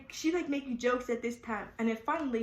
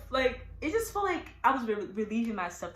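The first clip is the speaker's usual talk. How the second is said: usual speaking voice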